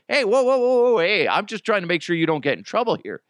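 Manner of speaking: frantic peacemaker, hands up; alarmed placating. Retold or imitated. Calming a fight.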